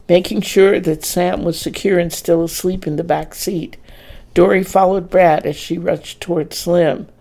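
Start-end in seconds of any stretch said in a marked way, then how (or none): none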